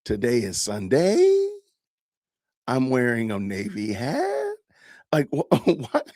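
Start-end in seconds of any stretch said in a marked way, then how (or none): 0.1-4.5 each statement lifted into a question at the end; upspeak demo. Shown as a demonstration. Demonstrating upspeak to his chat, slow rising statements. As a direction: make plain statements rise like questions. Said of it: "statements that sound like questions".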